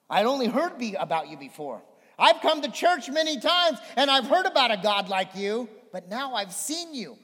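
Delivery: loud, high preacher's voice driving the scripture home; full-force preaching. Acted. Pastor booming Job's words to the church, full-force preaching. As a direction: preach it out big to the congregation. Preaching on Job forty-two.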